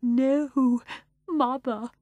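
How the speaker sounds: fearful, meek reply; trembling. A narrator's reading. Fanny answering her mother, trembling. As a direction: quaver it.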